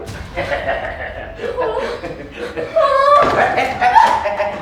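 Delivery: long, high gloating cackle; gloating villain laugh. Acted. Captor laughing at the trapped newcomers, gloating.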